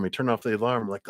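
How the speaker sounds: usual speaking voice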